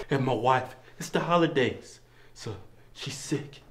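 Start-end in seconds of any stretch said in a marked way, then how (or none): none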